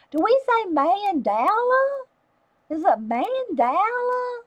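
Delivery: high, playful mock Southern mandala; a hint of Southern drawl. Asking the Southern girls, lightly mock Southern drawl. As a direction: tease it out like a Southern belle, a touch slow. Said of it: "down the South say it".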